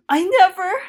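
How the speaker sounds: high, strained protest; Lancel protesting. Acted. Co-host voicing Lancel cutting in to deny. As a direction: blurt it out defensively.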